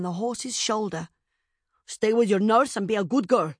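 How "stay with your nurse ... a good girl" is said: firm, louder maternal order; fearless command. A narrator's reading. Mother on horseback to frightened daughter, commanding firmly. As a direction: command it without any fear. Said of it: "the woman commanded, no trace of fear in her voice".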